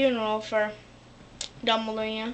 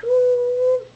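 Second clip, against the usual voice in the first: mock ooh; 12 semitones higher, 7 dB louder than usual.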